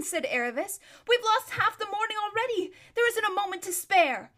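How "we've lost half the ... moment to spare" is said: put-on high, loud impatient girl. A narrator's reading. Aravis urges Bree not to waste time. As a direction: press them to hurry.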